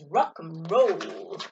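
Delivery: low, slow cool-cat drawl; cool rock and roll. Acted. Pete says his cool catchphrase, low and slow. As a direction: say it like a laid-back cat. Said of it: "He says".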